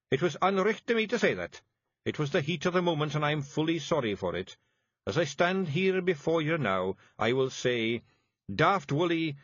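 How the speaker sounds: quick, high Scottish, mock-grand; solemn Feegle apology. A narrator's reading. Rob Anybody apologises to Daft Wullie, grand Scottish chief. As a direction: make a big formal show of sorry.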